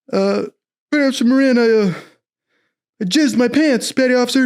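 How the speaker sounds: high-pitched recruit confessing; hesitant mock report to an officer; sheepish report. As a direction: pitch it high and stumble into the admission.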